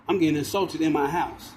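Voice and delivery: ordinary delivery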